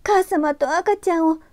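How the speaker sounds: put-on high, pleading girl's voice; 必死の祈り. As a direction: beg desperately as a young girl. ユキが神仏に必死に祈る. (必死に懇願する少女の声)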